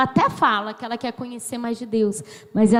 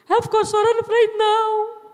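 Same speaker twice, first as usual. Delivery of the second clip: imitating crying; 8 semitones higher, faster than usual. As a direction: wail it as a retold crying fit. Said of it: "Cheguei no escritório chorando".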